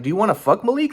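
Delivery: high-pitched incredulous demand; exaggerated indignant retort. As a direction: act out the outburst he didn't make. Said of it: "I didn't even respond like that".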